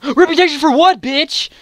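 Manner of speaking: gasping high mock outrage; outraged gasp. Real, not acted. Mocking outraged retort.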